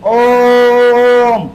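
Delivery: ओम with the ओ held for three beats; drawn-out pluta Om. Shown as a demonstration. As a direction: stretch the ओ in ओम long and loud. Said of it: "ओ को आपने कितनी बार बोला".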